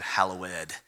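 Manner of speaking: "hallowed" with the -ed as its own syllable; King James pronunciation. Shown as a demonstration. Preacher showing the King James form, "hallowed" with sounded -ed. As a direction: say "hallowed" in three syllables, hal-lo-wed. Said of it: "put the emphasis on the ed".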